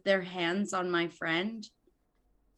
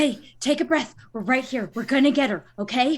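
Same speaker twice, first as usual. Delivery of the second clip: urgent reassurance; 4 semitones higher, 7 dB louder, faster than usual. Acted. Urgently steadying a panicking friend.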